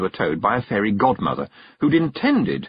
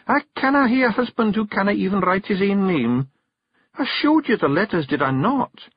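high Scots wife, firm; wife reminding husband of his lessons; scolding Kelda; 11 semitones higher than usual. Acted. Jeanie's voice.

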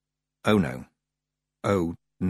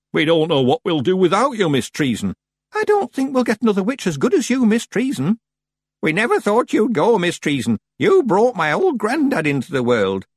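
villagers' voices; 10 semitones higher, 5 dB louder than usual. Acted. Villagers crowding the witch.

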